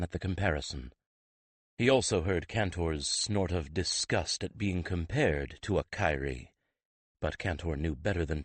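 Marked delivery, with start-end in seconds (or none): none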